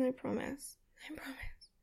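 fading unvoiced whisper; whispered promise. Promising her partner in a fading whisper. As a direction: breathe the promise away to nothing.

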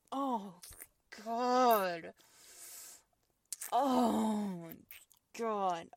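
loud drawn-out pleasured moans. Acted. Woman moaning to her partner, loud moans. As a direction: let each oh swell out.

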